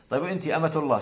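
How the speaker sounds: quick playful back-and-forth; mock phone exchange. Host playing both sides of an anonymous call. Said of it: "سمي حالك أمة الله".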